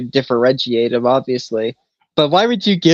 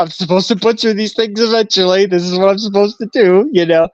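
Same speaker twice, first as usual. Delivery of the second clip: mock choked up; 7 semitones higher than usual. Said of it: "desperately hold back tears".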